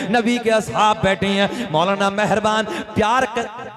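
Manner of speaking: slow, loud chanted sermon line; preaching at full force. Acted. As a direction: stretch each phrase like a preacher's chant. Preacher chants refrain slowly.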